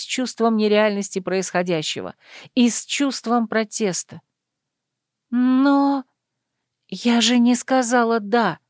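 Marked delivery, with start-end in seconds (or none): none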